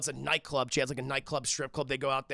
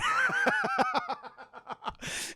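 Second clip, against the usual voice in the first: very high-pitched drawn-out cackle; high laugh. Real, not acted. Streamer after the cantaloupe kill, high-pitched laughing. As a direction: crack up in a high squeal.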